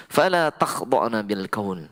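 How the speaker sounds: slow, measured recitation of the verse; Quran recitation. Shown as a demonstration. Preacher reciting the verse to the women, slow. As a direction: recite it slowly and carefully. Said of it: "Pegang ini baik-baik".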